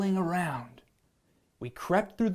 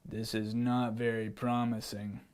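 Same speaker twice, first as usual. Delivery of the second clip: lion voice; 6 semitones lower, 7 dB quieter than usual. Acted. Lion checks the scores.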